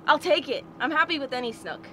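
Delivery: ordinary delivery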